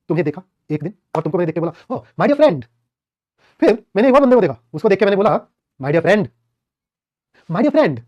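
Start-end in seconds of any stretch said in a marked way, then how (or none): 2.0-2.6 warm, higher, friendly "my dear friend"; friendly greeting. Shown as a demonstration. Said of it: "मैंने दोनों को बात तो सेम ही बोली है ना". 5.8-7.9 sarcastic. Shown as a demonstration. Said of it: "but क्या मैंने बात सेम बोली?".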